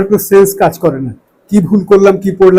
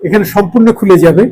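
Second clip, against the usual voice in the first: ordinary delivery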